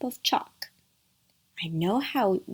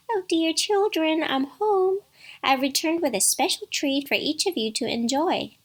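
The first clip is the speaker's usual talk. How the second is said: high, sweet falsetto; wolf coaxing in a put-on mummy voice; imitating Mother Goat; 7 semitones higher than usual. A narrator's reading. Wolf returns to the cottage, imitating Mother Goat. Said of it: "It sounds like Mummy".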